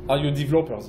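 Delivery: usual speaking voice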